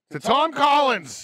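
big, high, shouted cheer; loud toast. Real, not acted. Friends toasting over cocktails, loud shouted cheer. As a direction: raise the glass and shout the toast.

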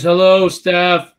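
loud, drawn-out greeting; calling out. Retold or imitated. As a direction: call it out slowly to someone off mic. Relaying a greeting across the room.

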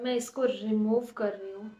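peeling mask off face; thin mask over lips; through a sheet mask. Real, not acted.

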